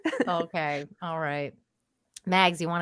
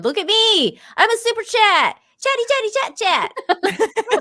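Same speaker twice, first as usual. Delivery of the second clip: silly high voice; 13 semitones higher, 6 dB louder than usual. Pretend. Playfully voicing a super chat.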